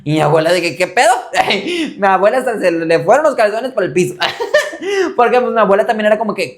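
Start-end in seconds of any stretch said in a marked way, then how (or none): 0.8-1.1 higher and louder, caught off guard; a grandmother's alarmed outburst; startled grandma. Retold or imitated. 1.2-1.9 real amused laughter; high laugh. Real, not acted. Laughing at own story. 4.2-5.1 real amused laughter; high laugh. Real, not acted. Laughing at own story.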